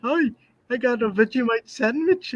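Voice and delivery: high-pitched caricature of an Australian; mock Australian accent. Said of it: "there's not an Australian coming in here".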